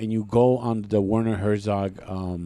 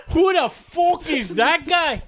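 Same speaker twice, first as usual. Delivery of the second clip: put-on high, slow, drawn-out Irish accent; exaggerated Irish taunt, stretched out; Conor McGregor; 4 dB louder, slower than usual. Showing a friend what Irish sounds like, mock Irish accent. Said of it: "That's Conor McGregor".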